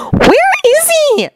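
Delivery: high, drawn-out irritable question. Acted. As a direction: ask it high-pitched and put out. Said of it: "Sakura asked irritably".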